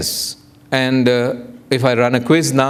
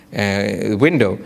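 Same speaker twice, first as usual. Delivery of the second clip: normal talking voice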